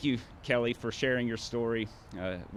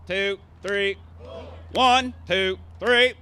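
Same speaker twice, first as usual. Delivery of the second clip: loud, higher drill-sergeant cadence calls; drill commands; 7 semitones higher, 5 dB louder, much slower than usual. Shown as a demonstration. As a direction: bark PT commands at the formation. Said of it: "so everybody stand up".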